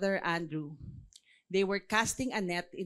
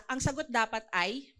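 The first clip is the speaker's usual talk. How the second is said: ordinary delivery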